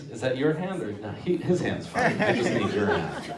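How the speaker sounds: usual speaking voice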